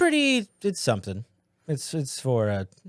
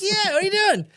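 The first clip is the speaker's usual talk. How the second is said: squeaky high-pitched voice; 3 dB louder than usual. Acted. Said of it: "A little higher?".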